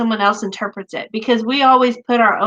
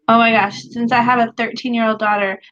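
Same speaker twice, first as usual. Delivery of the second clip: ordinary delivery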